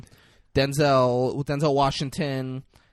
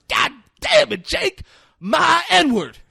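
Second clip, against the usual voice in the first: hammed-up outburst; 9 dB louder than usual. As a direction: crow to the room in mock amazement. Denzel's outburst when held at gunpoint.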